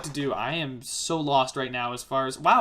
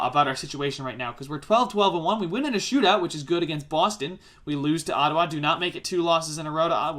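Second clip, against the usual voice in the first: ordinary talk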